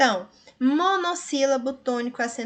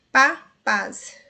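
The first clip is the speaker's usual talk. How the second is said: "Pá, pás" both said much louder and strong; stressed demo; 8 dB louder than usual. Shown as a demonstration. Teacher demonstrating accented monosyllables to students, loud. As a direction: belt out "Pá" then "pás" for the class.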